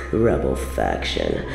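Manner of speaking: very low, dismissive echo; scornful. Acted. Queen scoffing at the rebels' name, low and disdainful. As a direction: drop deep in contempt.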